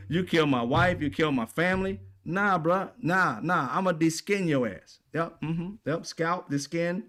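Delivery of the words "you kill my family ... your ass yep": ordinary talk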